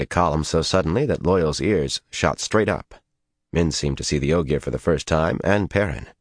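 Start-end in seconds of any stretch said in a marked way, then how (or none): none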